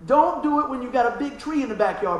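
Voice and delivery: normal talking voice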